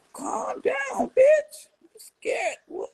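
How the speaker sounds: high shrill mock scream of "calm down, bitch"; shrieking calm down. Mocking a woman screaming on camera, shrieked high. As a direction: shriek it way up high like you're panicking.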